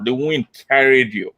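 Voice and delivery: ordinary talk, nothing marked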